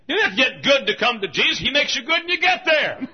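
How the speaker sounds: high, loud revival exhortation; full-force preaching. Real, not acted. Preacher pressing sinners to come, shouted high. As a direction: proclaim it hard to the whole congregation.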